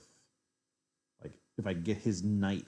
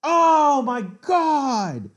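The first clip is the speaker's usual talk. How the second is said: very slow, high, loud Oh my God; long anguished scream; drawn-out screaming wail; 16 dB louder, much slower than usual. Real, not acted. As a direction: stretch it out in agony. Accidental mouse-slip chess blunder.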